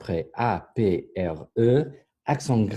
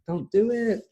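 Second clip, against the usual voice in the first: high-pitched mock warning; playful warning; 11 semitones higher, 3 dB louder than usual. Real, not acted. Teacher warns students about leaving conditioner in, playful high-pitched warning. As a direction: plead it, pitched way up.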